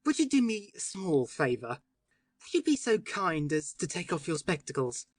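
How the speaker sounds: careful, polite request; each word set down precisely and courteously. A narrator's reading. Syme asking the doctor, precise and courteous. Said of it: "in a voice peculiarly precise and courteous".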